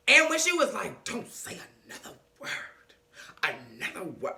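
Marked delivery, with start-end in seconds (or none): 1.0-4.3 hushed, breathy near-whisper; soft secretive hush; whispered warning. Retold or imitated.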